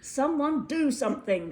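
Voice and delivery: mayor commands the townsfolk, loudly; declared demand. Acted. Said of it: "the mayor declared".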